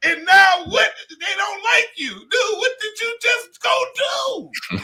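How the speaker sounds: high, mounting exasperated bafflement; Anthony Anderson, perplexed. Retold or imitated. Comic imitating his friend questioning the flop, perplexed and high. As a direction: wail it in disbelief, dragging the end. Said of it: "He was so perplexed".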